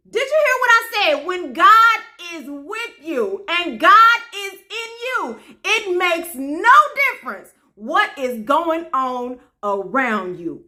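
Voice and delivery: high, slow, drawn-out proclamation; insistent church exhortation; emphatic preaching. Real, not acted. Repeats the message, preaching harder.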